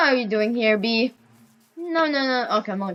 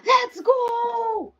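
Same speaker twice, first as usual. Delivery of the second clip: triumphant shout; 14 semitones higher than usual. Real, not acted. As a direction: yell it out in triumph. Celebrating success.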